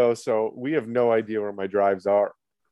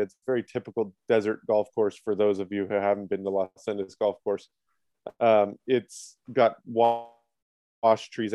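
ordinary talk, nothing marked

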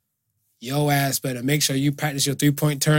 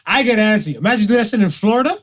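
normal talking voice

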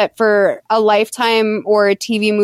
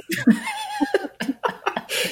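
real laugh at the story; high burst of laughter; 9 dB quieter than usual. Real, not acted. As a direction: laugh breaks out high and airy. Big laugh after quote.

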